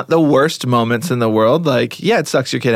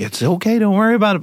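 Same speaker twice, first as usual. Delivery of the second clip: higher comforting parent voice; consoling; 9 semitones higher than usual. Retold or imitated. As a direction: soothe the child gently. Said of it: "be like".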